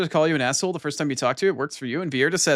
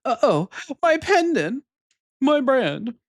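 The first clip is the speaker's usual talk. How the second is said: high-pitched, drawn-out dismay; game character voice; 11 semitones higher, 3 dB louder, much slower than usual. Acted. Marle realizing her pendant is gone, high dismayed character voice. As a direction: play a tomboy heroine's high cry of alarm. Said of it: "what voices I wanna do for these characters".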